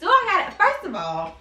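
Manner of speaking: a hint of outraged disbelief; indignant retort. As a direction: throw the question back at him, offended. Retorts to "so you got an attitude.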